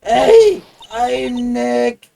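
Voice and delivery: shouting it at the camera. Acted. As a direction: call out loudly. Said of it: "not saying it loud enough".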